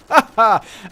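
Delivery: short, high, loud laugh. Real, not acted. Customer laughing after a cold pun, amused. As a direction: crack up at your own pun.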